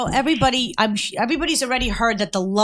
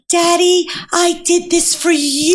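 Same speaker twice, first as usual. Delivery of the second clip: emotional quote; 8 semitones higher, slower than usual. Retold or imitated. Retelling the sister's emotional moment.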